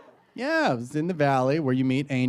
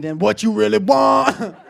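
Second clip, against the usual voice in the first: loud, drawn-out rapper impression; 5 semitones higher, 8 dB louder, slower than usual. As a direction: belt it like a menacing rapper. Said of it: "stop doing that voice".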